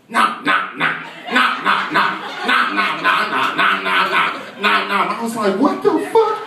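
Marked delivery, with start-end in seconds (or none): 0.1-5.2 repeated "nah" as mock biting noise; imitated chomping. Hyper boy snapping at his teacher, mock chomping noises. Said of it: "He starts doing this". 5.6-6.4 very high incredulous outburst; shocked disbelief. Acted. Teacher seeing the boy chomp, high-pitched disbelief.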